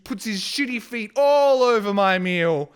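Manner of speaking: loud, high, indignant narration; outraged at the thieving bird; angry retelling. Real, not acted. Describing the seagull to viewers, loud and angry. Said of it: "I'm very angry".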